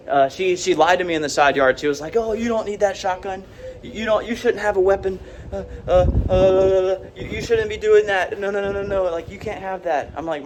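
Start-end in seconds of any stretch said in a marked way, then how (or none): 2.2-10.1 higher-pitched imitation of a protesting sister; mock scolding sister. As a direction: mimic her fussy objections, pitched up. Acts out sister objecting to shotgun.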